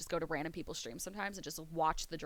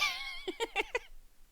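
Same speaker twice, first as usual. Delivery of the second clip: high cackling laugh; 4 dB quieter than usual. Real, not acted. Laughs after his punchline.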